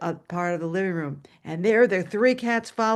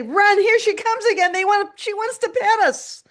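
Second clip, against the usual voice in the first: high, louder voice of fleeing cats; mock alarm; 12 semitones higher, 4 dB louder than usual. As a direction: raise a playful alarm, high and urgent. Cats panicking at her approach.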